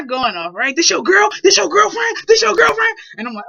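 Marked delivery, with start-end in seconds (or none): 0.7-3.0 loud, higher, rising each time; retold furious dad, loud and high; dad yelling accusations. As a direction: build the accusing yell louder and higher.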